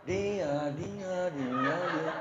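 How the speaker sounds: mock the gossip's obsession; teasing, fading repetition. Mimics gossip fixation.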